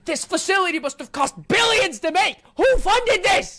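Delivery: real outraged shout; incredulous shout. Real, not acted. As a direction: shout it at the huge facility. Shouting at absurd facility.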